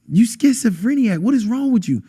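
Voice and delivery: high-pitched, indignant imitation of the girlfriend; imitated scolding. Girlfriend berating the man who screamed, mock scolding. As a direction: mimic her scolding him in disbelief. Acted quote of angry girlfriend.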